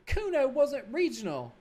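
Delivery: high, slow, sneering brat voice; bratty kid Kuno. Acted. Cocky kid mocking the cop, in a high bratty voice.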